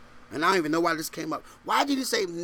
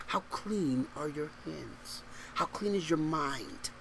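slow, much softer and lower; hushed searching question; 3 semitones lower, 9 dB quieter, slower than usual. Real, not acted. Preacher asks congregation to examine themselves, hushed and slow. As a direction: let the question sink in, slowly and quietly.

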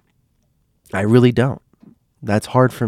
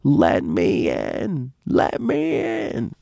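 imitating a dream ghost, words stretched out very slowly; slow, drawn-out mock wail rising very high; eerie screaming grandmother; 6 semitones higher, much slower than usual. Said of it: "He says it in this voice, like".

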